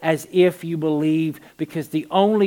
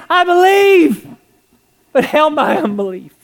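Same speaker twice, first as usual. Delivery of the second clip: high, loud cry of desperate faith; yelling; 9 semitones higher, 8 dB louder than usual. Retold or imitated. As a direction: cry it out at full voice. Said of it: "He's yelling".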